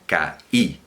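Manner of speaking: "Caí" separated into ca and Í; "Caí" broken syllable by syllable; two syllables. Shown as a demonstration. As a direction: pause briefly inside "Caí", then land on the í. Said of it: "Dos sílabas".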